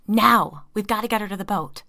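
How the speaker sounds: loud, fast urgent command; urgent shout. A narrator's reading. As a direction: yell it to hurry them.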